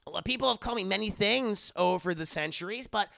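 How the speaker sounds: sweet bubbly introduction; perky girl voice. Acted. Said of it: "She goes".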